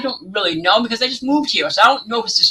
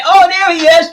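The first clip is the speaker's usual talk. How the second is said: mock neighbor; 8 semitones higher, 4 dB louder than usual. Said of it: "he said to me".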